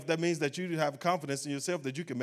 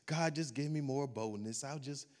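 a weak, much softer mock prayer, trailing off; mock timid prayer; 8 dB quieter than usual. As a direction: pray it meekly, almost under your breath. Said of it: "and you pray like this".

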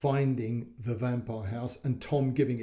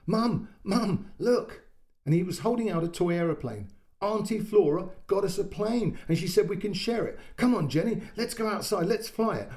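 put-on high, eager child voice; a boy bursting with news; excited little boy; 7 semitones higher than usual. A narrator's reading. Boy shows mum the plane from Auntie Flora, excitedly.

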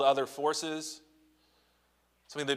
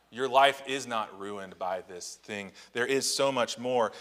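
ordinary delivery

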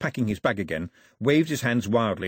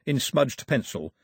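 ordinary delivery

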